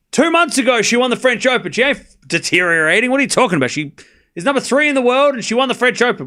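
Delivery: loud, high and worked up, insisting; heated defense. Real, not acted. Streamer loudly defending Coco to chat, indignant and high-pitched. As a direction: shout the facts back, indignant.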